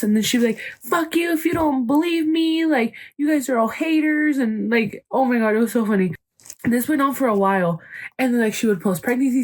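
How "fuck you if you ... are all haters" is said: higher, defiant snap at the doubters; mock angry retort. She fires back at doubters, mock angry. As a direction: throw it back at them like a mock tantrum.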